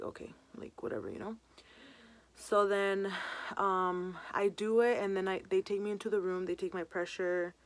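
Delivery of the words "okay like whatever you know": whispered, resigned okay; a quiet resigned whatever; muttered shrug. Retold or imitated. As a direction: mumble it, giving in. Nervous resigned reply.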